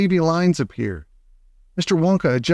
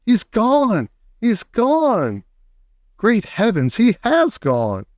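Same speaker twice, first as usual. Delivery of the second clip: high panicked scream; 8 semitones higher, slower than usual. A narrator's reading. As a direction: cry it out in horror. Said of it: "and she screamed".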